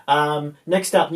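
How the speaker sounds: ordinary delivery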